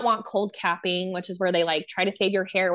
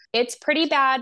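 reassuring but strained; 6 semitones higher, 4 dB louder than usual. Retold or imitated. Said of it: "I just try to reassure them".

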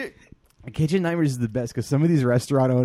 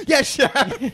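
meek cook; 12 semitones higher, 7 dB louder than usual. Retold or imitated. Cook voice in Ramsay scene.

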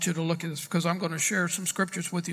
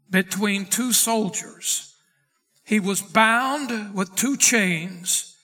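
raised, forceful preacher's Bible reading; emphatic scripture reading; 3 semitones higher, 5 dB louder than usual. Acted. Preacher reading Peter's prison escape to his class, emphatic and forceful. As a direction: proclaim the verse with weighty emphasis. Reads scripture aloud to congregation.

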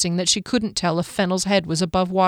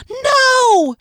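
full high scream, much louder; 7 dB louder than usual. A narrator's reading. Kier seeing Fennel faint, screaming. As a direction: scream it as he passes out. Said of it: "she screamed".

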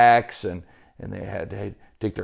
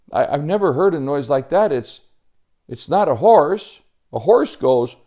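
higher, wondering story-character voice; puzzled Joey; 6 semitones higher, 5 dB louder than usual. Acted. Joey rules out animals after a strange noise, puzzled. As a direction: puzzle it out aloud, a touch excited.

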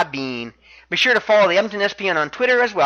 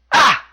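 short, high, loud yelp; one sudden punched "Ah!" in imitation; Tozawa's "Ah!" cry; 6 semitones higher, 5 dB louder than usual. Wrestler answers into the mic, short high imitated yelp. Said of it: "Tozawa simply says into the mic".

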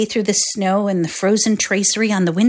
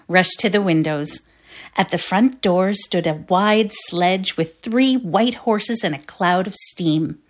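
plain reading voice

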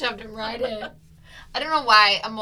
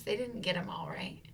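quick whispered aside; 3 semitones lower, 9 dB quieter than usual. Real, not acted. Teasing her co-host, whispering the question. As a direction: drop to a conspiratorial whisper.

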